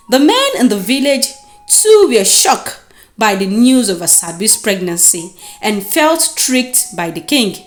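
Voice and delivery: usual speaking voice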